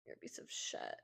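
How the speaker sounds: breathless mock insult, no voice; whispered joke insult. Teasing the viewers who drink, whispered. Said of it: "Just kidding".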